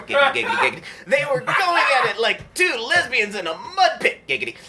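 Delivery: sleazy, gleeful catchphrase; catchphrase giggity. Acted. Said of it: "it's your old pal, G Q".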